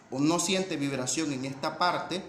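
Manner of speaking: ordinary delivery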